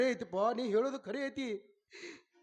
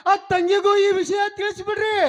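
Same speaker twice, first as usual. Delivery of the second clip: loud, higher urgent request; calling out; 7 semitones higher, 10 dB louder than usual. Acted. Son calling to his mother as she leaves, loud request. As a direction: make sure she hears it.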